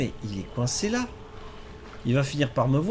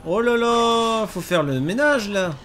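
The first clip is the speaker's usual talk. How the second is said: alarmed oh là là; 9 semitones higher, 4 dB louder than usual. Real, not acted. Zombies on the roof.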